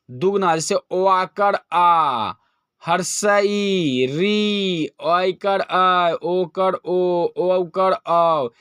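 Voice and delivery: each vowel stretched to double length, very slow; long vowels drawn out. Shown as a demonstration. Teacher stretching long vowels for students, slow and drawn out. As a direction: hold every vowel twice as long as a short one. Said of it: "दुगना समय लगता".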